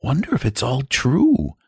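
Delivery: Charlotte musing. A narrator's reading. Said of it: "said Charlotte".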